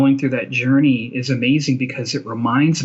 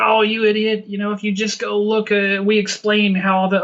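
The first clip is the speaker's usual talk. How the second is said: higher, scoffing mock-atheist voice, a little hammed up; mock scornful atheist; 6 semitones higher than usual. Atheist listener scoffing at the host, higher mock scorn. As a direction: scoff down at the fool, half in jest. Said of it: "the atheists listening to this will be like".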